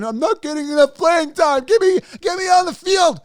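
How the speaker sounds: high, loud mock complaint; mock whining player. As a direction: whine for playing time like a spoiled player. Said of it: "the guy that was complaining".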